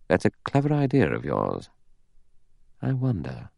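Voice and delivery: quietly musing; thoughtful. Acted. Philip weighing Vera's idea, thoughtfully. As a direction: mull it over, softening at the end. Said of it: "Philip looked at her thoughtfully".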